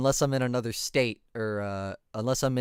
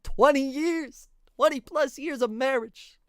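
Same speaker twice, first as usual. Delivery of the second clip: mock outrage; 12 semitones higher than usual. Mocking the chat's guess at his age, hammed-up high exclamation. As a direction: throw it up high like a scandalized shout. Mocking the commenters' marriage claim.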